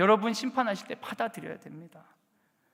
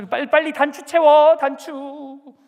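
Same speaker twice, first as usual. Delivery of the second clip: impatient yell; 7 semitones higher, 11 dB louder, slower than usual. Retold or imitated. Mother hurrying late child.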